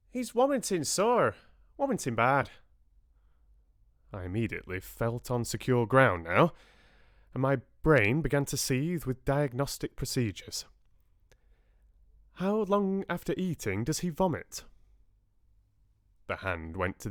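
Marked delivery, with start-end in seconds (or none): 0.1-2.5 high-pitched Irish brogue; emphatic, high Irish lilt; rich Irish accent. A narrator's reading. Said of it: "spoke with a rich, unexpected accent". 12.3-14.6 raised voice. A narrator's reading. Vet shouts to deaf client.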